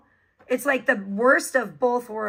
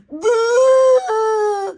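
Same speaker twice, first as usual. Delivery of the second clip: high, loud mocking wail; mock slow-witted voice; 12 semitones higher, 9 dB louder than usual. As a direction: blare it out in crude mockery of her voice.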